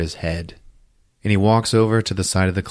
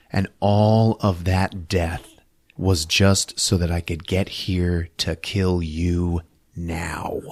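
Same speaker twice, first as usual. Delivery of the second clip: slow, deliberate villain threat; menacing threat; slower than usual. Retold or imitated. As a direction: draw it out slowly, sinking to a whisper on the last word.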